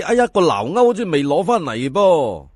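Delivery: ordinary delivery, nothing marked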